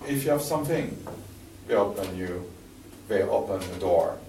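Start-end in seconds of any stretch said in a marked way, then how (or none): none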